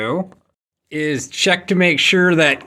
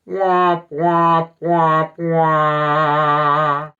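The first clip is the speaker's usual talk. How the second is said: womp womp sound; much slower than usual. Acted. Sad trombone after ad fail.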